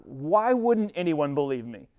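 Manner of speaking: usual speaking voice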